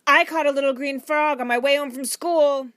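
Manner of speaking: put-on high, loud little boy's news; eager interruption. A narrator's reading. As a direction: blurt it out over the grown-ups. Boy interrupts with his news.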